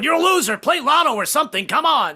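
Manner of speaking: high, loud nagging yell; nagging wife. Retold or imitated. Wife in bed yelling at her husband to do something. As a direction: nag him from the bed. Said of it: "my wife in bed ... going".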